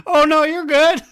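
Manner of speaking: high, loud, over-polite reply; hammed-up 'no worries' squeak; mock reassurance. Acting out own reply to apology.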